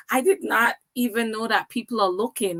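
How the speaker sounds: ordinary talk, nothing marked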